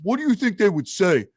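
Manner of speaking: lightly voiced Dice Clay needling a front-row guy; a hint of Andrew Dice Clay ribbing the crowd; imitating Dice Clay. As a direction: toss him a smug, teasing question. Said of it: "He says".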